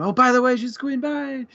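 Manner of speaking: high, breezy and quick, fading off on bye; mock breezy dismissal. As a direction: toss it off like a hurried plot summary. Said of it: "a thing and".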